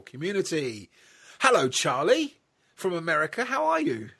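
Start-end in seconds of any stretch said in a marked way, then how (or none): 1.4-4.1 high bright put-on greeting; mock American. London host greeting the American with a playful high voice.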